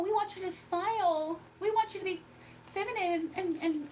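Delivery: high put-on voice of people policing women; mocking mimicry. Mimicking those who want her smiling and feminine, high mocking voice.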